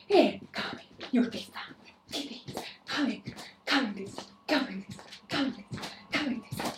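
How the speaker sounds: panting through jumping jacks, out of breath. Real, not acted. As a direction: gasp the words out of breath. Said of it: "Now I'm out of breath".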